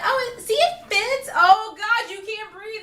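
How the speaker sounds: very high, loud mock chirp; squeaky mock saleswoman. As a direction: chirp it like a clueless clerk. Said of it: "she's looking at me like".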